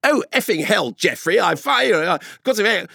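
put-on high, braying posh English accent. Posh English swearer on a train, mock accent. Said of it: "cut glass accent".

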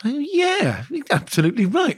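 smooth posh RP voice; RP accent. Retold or imitated. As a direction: persuasive received pronunciation. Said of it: "It's because of my R P voice".

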